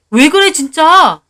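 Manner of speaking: loud, slow, sharp reproach; husband's rebuke. Acted. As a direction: stop her with a loud rebuke. Husband grabs her arm. (남편의 언성 높인 나무람)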